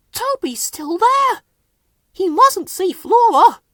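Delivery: very high hushed alarm; a startled hushed cry. A narrator's reading. Thomas alarmed in story.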